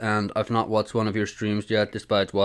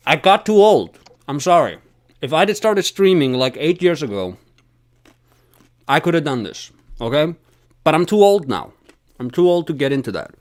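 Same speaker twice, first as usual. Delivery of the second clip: exasperated protest; 5 semitones higher, 6 dB louder than usual. Real, not acted. Protests he is too old.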